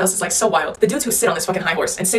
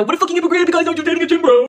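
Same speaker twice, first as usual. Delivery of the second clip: high, loud mock outrage; mocking gym-bro critics; 9 semitones higher, 4 dB louder than usual. Critics calling her a hypocrite over her man, mocking. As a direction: imitate an accusing critic, pitched way up. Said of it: "And they go".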